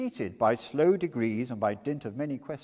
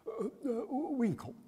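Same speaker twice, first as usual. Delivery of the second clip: higher, flustered answer; startled timid reply; nervous young Winkle; 12 semitones higher, 3 dB quieter, slower than usual. A narrator's reading.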